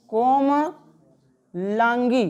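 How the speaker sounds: कोमलांगी stretched out, ko-ma-laan-gee; कोमलांगी drawn out syllable by syllable; very slow dictation. Shown as a demonstration. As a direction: spell out कोमलांगी very slowly. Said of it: "देखो ऐसे लिखे हो कि गलत लिखे हो?".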